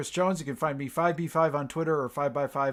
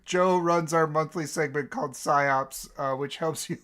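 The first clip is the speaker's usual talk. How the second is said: ordinary talk, nothing marked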